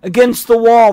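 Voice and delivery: loud order to the wall; repeated command. A narrator's reading. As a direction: bark it a second time. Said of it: "the first policeman repeated".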